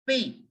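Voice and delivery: "pay" as the French name of P; crisp French letter name "pay". Shown as a demonstration. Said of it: "P will be pronounced as".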